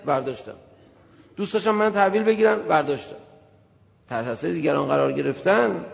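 usual speaking voice

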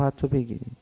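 soft, falling reply on "حتی"; subdued answer. Retold or imitated. Omar Sa'd accepts losing Rey, subdued "حتی". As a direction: answer "حتی" quietly, giving way. Retold resigned answer to the threat.

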